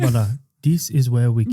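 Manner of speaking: mock Sudanese accent; Sudanese man. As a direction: play a Sudanese man showing guests round. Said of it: "a guy from the Sudan".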